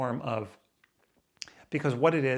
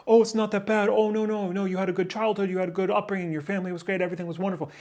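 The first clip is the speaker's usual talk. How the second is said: higher, lightly mocking voice of his own brain; inner reassuring voice; 8 semitones higher, 4 dB louder, faster than usual. His inner voice telling him it's not that bad, higher mock reassurance. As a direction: brush off his own complaints in a sing-song. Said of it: "in my brain, it's like".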